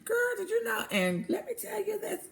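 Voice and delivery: high-pitched, chatty gossip voice; gossiping lady. Shown as a demonstration. Teacher acting out gossiping ladies for her class, high chatty gossip voice. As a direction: lean in and spill the news like a gossipy friend. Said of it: "That's gossiping".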